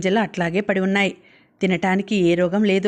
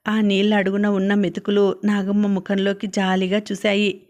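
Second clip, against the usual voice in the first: ordinary delivery, nothing marked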